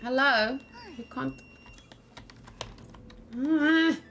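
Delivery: usual speaking voice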